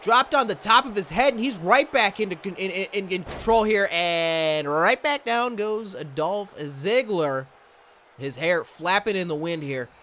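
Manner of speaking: high, excited ringside commentary; play-by-play. Acted. Calling the Dunne versus Ziggler match, excited play-by-play. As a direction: call every move as it lands. Calls the simulated match live.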